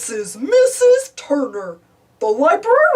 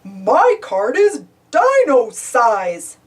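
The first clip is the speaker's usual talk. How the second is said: chuckling; 4 dB louder, much slower than usual. A narrator's reading. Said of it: "chuckles Barney".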